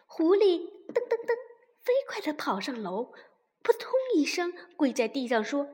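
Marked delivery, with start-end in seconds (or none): none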